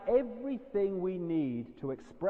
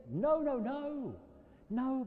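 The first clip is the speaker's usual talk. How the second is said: high, slow and drawn out; firm drawn-out refusal; slow emphatic no; 9 semitones higher, much slower than usual. Real, not acted. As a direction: stretch each no up high. Emphatic denial of the voice.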